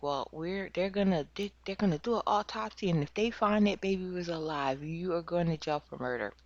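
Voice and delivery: high, fast mock-stern warning; warning parent. Parent warning Brooke about the autopsy, a high hurried warning. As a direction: rush out the threat like a scared parent. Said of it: "she's like".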